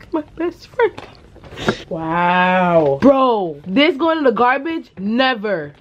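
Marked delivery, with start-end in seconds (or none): none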